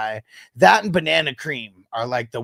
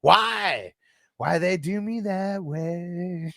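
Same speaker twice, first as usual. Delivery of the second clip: high, slow whiny complaint; mock lament; 7 semitones higher, much slower than usual. Real, not acted. Streamer whining at a viewer's tough start-sit question. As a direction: groan it like you got robbed. Lamenting a bad fantasy pick.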